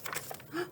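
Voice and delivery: quick breathy gasp of wonder; soft gasp. Acted. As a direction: gasp as the page turns. Teacher gasps at page turn.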